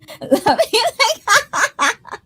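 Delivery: high, long mock cackle; exaggerated high cackle; emphasized witchy laugh. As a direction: put on a high, drawn-out Halloween laugh. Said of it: "gonna emphasize the laugh for tonight".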